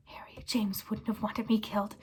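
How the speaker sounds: breathy pleading whisper. Acted. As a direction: whisper your plea. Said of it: "whispered Pettigrew".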